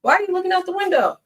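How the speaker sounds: high sing-song voice for the dog; pet talk. Real, not acted. Owner coaxing her dog away from the window, high cooing pet talk.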